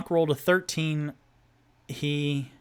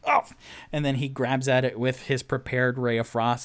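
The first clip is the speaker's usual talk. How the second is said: ordinary talk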